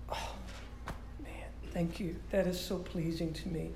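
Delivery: God pleased. Retold or imitated. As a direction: lightly voice God savoring an offering. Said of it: "something that God goes".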